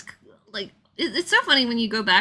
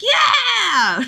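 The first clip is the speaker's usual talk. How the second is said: much higher, loud cheer; gleeful shout; 12 semitones higher, 6 dB louder than usual. Retold or imitated. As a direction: shout in delighted agreement. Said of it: "I'm like".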